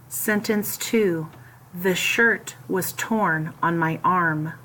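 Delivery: slow, careful dictation voice. Acted. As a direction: read each sentence slowly for students to write. Slow dictation of test sentences.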